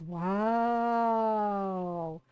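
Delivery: long, low-pitched impressed wow; drawn-out wow. Retold or imitated. Tito hears she made him cry, drawn-out amazement. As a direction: stretch it out in mock amazement.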